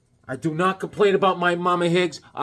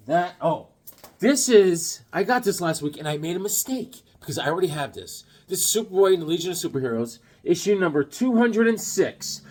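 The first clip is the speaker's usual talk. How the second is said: ordinary talk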